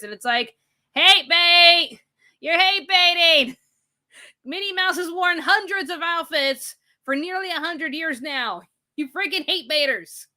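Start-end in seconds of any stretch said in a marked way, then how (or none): 1.0-3.6 loud, high-pitched accusing yell; exasperated shout. Acted. Streamer calling out outrage-bait news, exasperated shout. Acted outburst at hate-baiting media. 4.4-10.3 high-pitched indignant protest; exasperated rant. Acted. Streamer telling off outlets over Minnie's outfits, exasperated rant. Ranting back at the news outlets.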